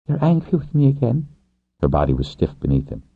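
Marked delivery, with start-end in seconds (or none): 0.1-1.3 much higher woman's voice, questioning. Acted. Woman rider asking her lover if he's angry, questioning. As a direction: ask it warily in a high register. She questions his anger.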